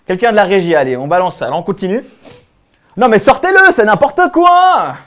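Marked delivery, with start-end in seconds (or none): 3.0-4.9 loud, high demand with a drawn-out ending; outraged shout. Acted. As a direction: shout for them to throw him out. Exige qu'on sorte le SDF. (cri scandalisé)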